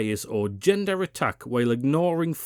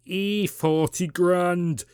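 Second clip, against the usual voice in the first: slow put-on Northern English accent, Angela Rayner imagined. Commentator imagines the politician shrugging off tax, in a Northern accent. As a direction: drawl it like a Northern lass with a cocktail. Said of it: "Sorry for me accent".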